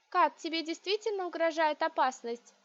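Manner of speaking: plain reading voice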